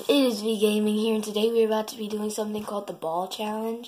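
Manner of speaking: loud upbeat vlog intro; energetic channel opener; youtuber intro. Acted.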